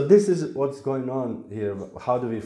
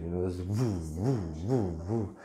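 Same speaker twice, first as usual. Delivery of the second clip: washing machine whoosh; 5 semitones lower, 7 dB quieter than usual. Retold or imitated. Laundry machine spinning sound.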